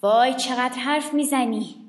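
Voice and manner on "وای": usual speaking voice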